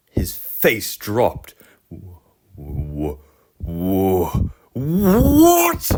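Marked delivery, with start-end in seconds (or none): 1.9-5.9 stunned disbelief at losing; shocked scream. Acted. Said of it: "screamed Sir Handel".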